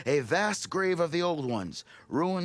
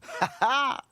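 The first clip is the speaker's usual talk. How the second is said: a high, loud, gleeful ha ha; triumphant laugh; 12 semitones higher, 3 dB louder than usual. Acted. Delver laughing gleefully when the rescuer agrees to help. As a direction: crow with delight at the good news.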